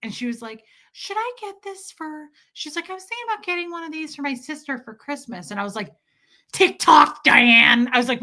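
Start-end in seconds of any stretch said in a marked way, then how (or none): 0.9-5.5 high, soft mock-sweet mom; nice mom voice. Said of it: "my mom's all nice to me". 6.5-7.9 loud, short snap at mom; cranky daughter snapping at mom; irritated snap. Retold or imitated.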